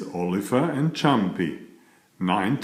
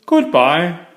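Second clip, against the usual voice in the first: louder farewell called after him; 7 semitones higher, 8 dB louder than usual. Acted. Mister Fussy calling after his departing cousin, loudly. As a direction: call it across the garden. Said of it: "called Mister Fussy".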